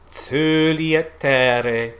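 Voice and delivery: slow "coeli" with rounded ö; ö vowel. Shown as a demonstration. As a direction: diction demo. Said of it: "that ö".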